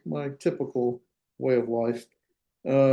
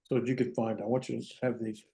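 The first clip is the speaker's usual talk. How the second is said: distant, faint voice from across the room; away from the mic; 3 semitones lower, 10 dB quieter, faster than usual. Real, not acted. Teacher searches for the page across the room, faint and distant. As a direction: keep talking while you walk away from the microphone. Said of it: "Let me just back over there".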